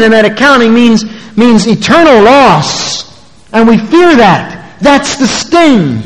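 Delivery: high, emphatic pulpit delivery; full-force preaching. Real, not acted. Preacher warning congregation of eternal loss, full-force preaching.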